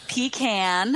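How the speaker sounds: PECAN as slow PEE-can, rhymes with van; first pronunciation variant. Shown as a demonstration. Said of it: "four ways to pronounce it".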